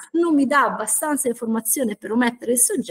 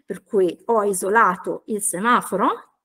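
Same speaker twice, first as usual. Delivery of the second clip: normal talking voice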